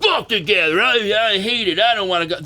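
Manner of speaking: whiny complainer. Retold or imitated. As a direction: parody a sulky fan who refuses to go. Mocking a complaining chatter.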